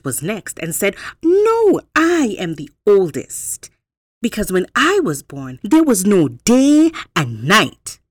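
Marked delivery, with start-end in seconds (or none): 1.2-8.0 eager rabbit. Acted. Rabbit protests.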